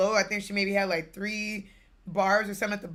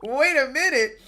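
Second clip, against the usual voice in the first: disbelief; 7 semitones higher, 6 dB louder than usual. Retold or imitated. Reacting to mom's story.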